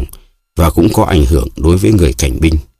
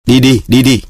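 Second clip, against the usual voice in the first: shooing shout; 7 semitones higher than usual. A narrator's reading. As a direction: drive the onlookers away. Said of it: "xua đuổi những người". (quát xua đuổi đám đông)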